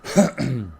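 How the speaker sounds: amused laugh at own joke; short laugh. Real, not acted. Laughs after mock doom.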